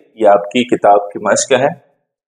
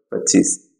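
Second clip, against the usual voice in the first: quiet demo; 5 dB quieter, slower than usual. Shown as a demonstration. Writing it with fingers.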